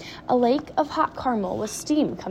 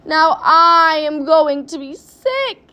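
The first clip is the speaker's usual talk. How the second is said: loud, slow, high wail; queasy yell; 6 semitones higher, 10 dB louder, slower than usual. A narrator's reading. Mother about to be sick tells Wonka, yelling. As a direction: drag it out in a yell. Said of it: "yelled Missus T V".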